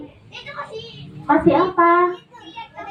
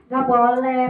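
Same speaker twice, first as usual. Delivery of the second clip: usual speaking voice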